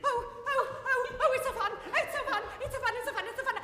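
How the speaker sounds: giddy, laughing squeals of joy; overexcited. Acted. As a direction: get carried away with delight. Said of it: "sorry, I got carried away".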